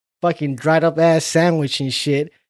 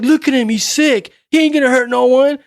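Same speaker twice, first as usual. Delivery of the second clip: cook quoted; 10 semitones higher, 6 dB louder than usual. Retold or imitated. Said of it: "Even the fucking cook is like".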